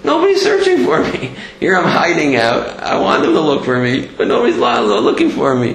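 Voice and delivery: high-pitched, plaintive cry of being unsought; tearful lament. Retold or imitated. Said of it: "I'm sure, is crying".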